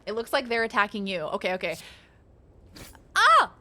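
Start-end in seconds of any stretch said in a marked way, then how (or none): 3.2-3.5 sharp high yelp, much louder; startled yelp. Real, not acted. Streamer yelping at the enemy's attack, startled yelp. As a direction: yelp as the hit lands.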